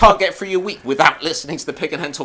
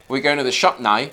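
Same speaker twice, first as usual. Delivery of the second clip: lower, demonstrated Northern Irish accent; 7 semitones lower than usual. Shown as a demonstration. Showing co-host the trick, Northern Irish accent. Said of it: "you just say".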